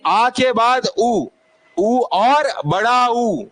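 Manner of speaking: slow, drawn-out listing of उ and बड़ा उ; slow vowel demo. Shown as a demonstration. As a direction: stretch each vowel for the class. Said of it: "slow vowel demo to class". (धीरे-धीरे स्वर गिनाना)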